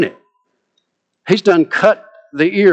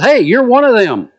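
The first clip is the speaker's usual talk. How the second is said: put-on accusing servant girl; 8 semitones higher, 4 dB louder than usual.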